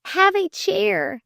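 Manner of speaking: high, halting nervous invitation; slow high-pitched stutter; stammering. A narrator's reading. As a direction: stumble over the offer, flustered. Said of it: "she stammered".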